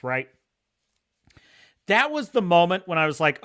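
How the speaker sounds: ordinary talk, nothing marked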